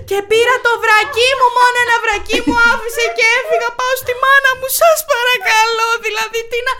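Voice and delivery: high, wailing plea; tearful stage monologue. Pretend. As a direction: keep up the melodrama for the officer. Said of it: "πατάω ένα μονόπρακτο πρώτο γυναικείο". (υψηλή θεατρική ικεσία)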